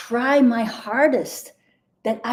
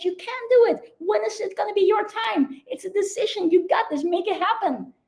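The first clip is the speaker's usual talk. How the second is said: higher, bright encouraging voice, full of belief; cheering good wolf; 8 semitones higher than usual. Acted. Good wolf cheering the listener on, higher and encouraging. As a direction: urge them on like a coach who believes in them. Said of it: "this wolf says".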